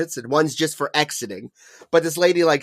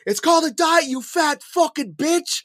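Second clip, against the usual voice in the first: retold parting insult, pitched way up; mock angry yell; 13 semitones higher than usual. Retold driver yelling an insult.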